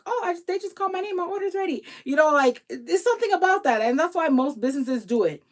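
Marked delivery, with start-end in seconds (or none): 0.1-1.8 higher, fast and thrilled; giddy mock excitement; delighted gush. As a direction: squeal that they called your name. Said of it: "little special feeling like".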